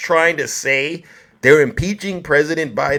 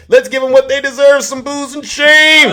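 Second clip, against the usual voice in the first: rallying shout; 9 semitones higher, 8 dB louder than usual. Acted. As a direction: whip the chat into booing. Rallying the audience to boo.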